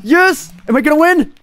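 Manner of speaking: giddy high-pitched yes; high excited cheering. Real, not acted. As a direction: cheer like you're winning. About to win game.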